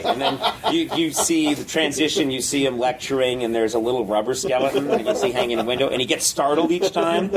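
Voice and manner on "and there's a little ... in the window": ordinary delivery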